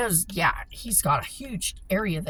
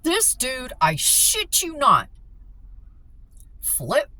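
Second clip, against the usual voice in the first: normal talking voice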